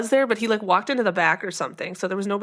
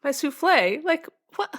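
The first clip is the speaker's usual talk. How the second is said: high, laughing disbelief; baffled and amused; exasperated; 6 semitones higher than usual. Retold or imitated. Mocking Lorelai's exasperation.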